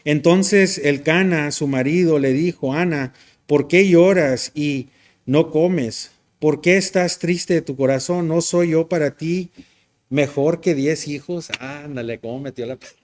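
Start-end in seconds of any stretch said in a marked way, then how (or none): 0.0-11.5 scripture reading. Acted. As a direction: proclaim the verse loudly, slowing down. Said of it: "dice así". (lectura bíblica fuerte y pausada)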